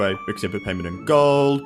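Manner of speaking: normal talking voice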